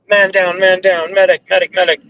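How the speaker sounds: high mock battlefield yell for a medic; hammed-up war movie cry for help; man down shout.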